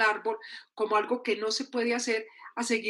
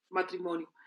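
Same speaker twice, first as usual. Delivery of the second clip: lower, softer tick-off of the demand; dutiful echo; 4 semitones lower, 7 dB quieter than usual. Acted. Answering what everyone expects, low dutiful echo. Expectation, then compliance ticked off.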